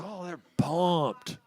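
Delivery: ordinary talk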